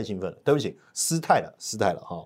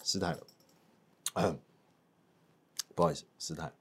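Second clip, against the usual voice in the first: sheepish apology; 9 semitones lower, 14 dB quieter, faster than usual. Real, not acted.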